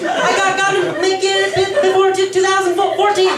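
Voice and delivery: panicked stammering plea. Acted. Frantic plea to wife.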